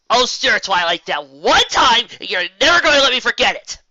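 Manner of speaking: loud, high, protesting complaint; exasperated outburst. Acted. Spike protesting to Twilight, loud and exasperated. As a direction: drag out that one time in exasperation.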